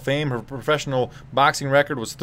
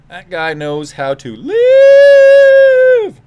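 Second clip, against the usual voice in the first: high, slow, booming drawn-out call; announcer hype; 12 dB louder, much slower than usual. Acted. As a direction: stretch it like a ring announcer. Drawn-out hype repeat of line.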